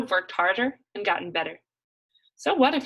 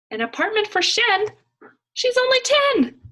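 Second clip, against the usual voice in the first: much higher, louder cry of disbelief; shocked outburst; 8 dB louder than usual. Acted. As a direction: squeal in outrage, stretching the last words. Shocked at sister's plan.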